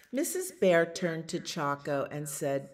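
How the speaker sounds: ordinary delivery, nothing marked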